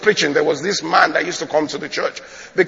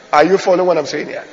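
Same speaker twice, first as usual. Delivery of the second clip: ordinary talk, nothing marked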